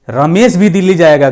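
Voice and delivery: "भी" पर ज़ोर, ऊँची और तेज़ आवाज़ में; रमेश भी दिल्ली जाएगा — "भी" को दबाकर बोलो; stress on भी. Shown as a demonstration. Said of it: "confirm है". ("भी" शब्द पर दबाव देकर पक्का करते हुए)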